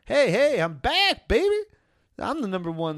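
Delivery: high, loud mock-triumphant comeback cry; Greg Olsen. Host voices a tight end stopping by, cocky shout. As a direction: burst in hollering like a returning star.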